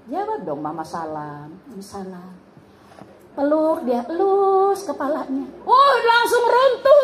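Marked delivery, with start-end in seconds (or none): 0.8-2.3 much lower and softer, grudging mumble; sulky child. Acted. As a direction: answer grudgingly like a sulking kid. Sulky child answers greeting.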